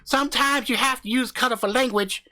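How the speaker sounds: brash country husband hyping up his channel; cocky backwoods YouTuber swaggering through the intro; crude hillbilly husband. Acted. Husband argues back.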